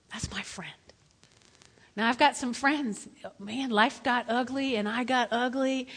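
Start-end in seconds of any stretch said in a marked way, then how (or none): 0.1-0.7 whispered. Real, not acted. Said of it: "repeated in whisper".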